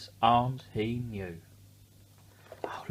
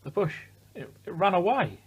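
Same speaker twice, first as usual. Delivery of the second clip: higher and louder, thrilled exclaim; excited; 9 semitones higher, 5 dB louder than usual. Real, not acted. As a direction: announce the surprise with a jolt of excitement. Bush suddenly runs off.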